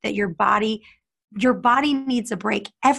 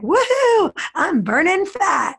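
high whooping cheer, much higher; mock cheer; 9 semitones higher, 3 dB louder, slower than usual. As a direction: cheer like she just won something. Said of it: "she's like".

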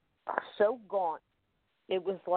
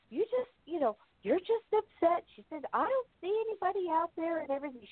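mom's voice; 11 semitones higher than usual. Retold or imitated. Mom voiced in a story.

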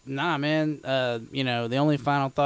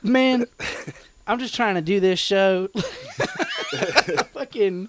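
laughing; 7 semitones higher, 5 dB louder than usual. Real, not acted. Laughing while complaining.

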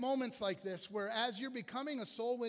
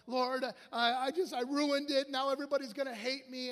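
loud, pitched-up self-loathing wail; mock panic; 4 semitones higher, 5 dB louder than usual. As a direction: imitate a man panicking at his mistake. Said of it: "he panicked. He started to self-loathe".

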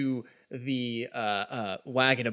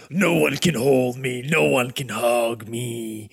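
defiant villain; 3 dB louder than usual. Acted. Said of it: "he breaks out".

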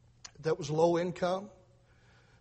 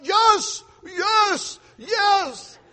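put-on triumphant Lebanese-accented yes, much higher and louder; 11 dB louder, much slower than usual. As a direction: cheer it in a Lebanese accent, fist in the air. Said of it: "in his Lebanese accent".

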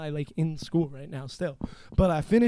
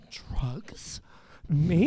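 feigned innocence. Retold or imitated.

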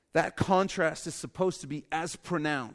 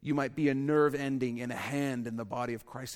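ordinary talk

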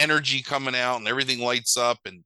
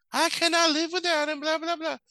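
much higher, hammed-up damsel in distress; panicked heroine. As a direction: play the frantic heroine over the top. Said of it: "and she's like".

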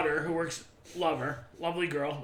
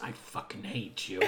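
low, quiet mock hatred; imitating quiet loathing; retold hateful remark; 9 semitones lower, 10 dB quieter than usual. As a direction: say it low and under the voice. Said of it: "That doesn't sound like you".

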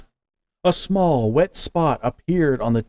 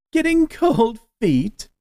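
much higher, slow mocking tease; teasing; 11 semitones higher, slower than usual. A narrator's reading. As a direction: needle him with a slow taunt. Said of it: "they teased".

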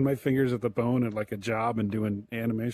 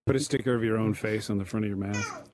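ordinary talk

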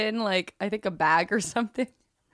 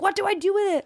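panicked question; 12 semitones higher, 4 dB louder than usual. Retold or imitated. Panicked call to mom.

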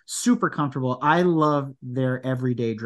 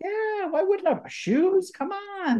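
mock high-pitched indignation; slower than usual. Playful defence of buying shoes.

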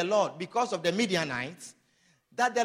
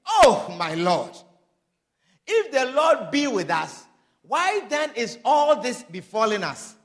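pleading complaint; 6 dB louder than usual. Acted. Gideon's complaint to the angel.

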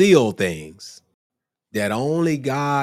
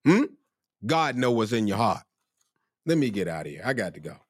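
ordinary talk, nothing marked

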